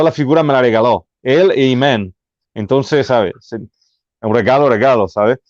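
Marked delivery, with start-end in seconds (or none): none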